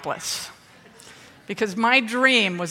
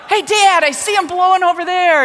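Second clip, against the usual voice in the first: much higher and louder, calling from the window; yelling to dad; 9 semitones higher, 7 dB louder than usual. Retold or imitated. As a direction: shout it out over the wind to dad. Kid yelling from car window.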